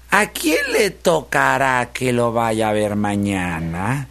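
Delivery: slow, drawn-out musing; scheming piglet. Acted. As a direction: wonder aloud who's next, lingering on the last word. Piglet picks his next victim. (cerdito maquinando, lento y alargado)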